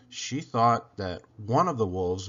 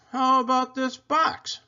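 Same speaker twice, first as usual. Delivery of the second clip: higher, lightly voiced young boy; a shy boy, pitched up; boy's voice; 12 semitones higher, 4 dB louder than usual. A narrator's reading. Boy answers Isabel about his box, higher boy voice.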